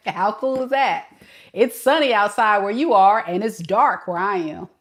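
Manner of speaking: ordinary talk, nothing marked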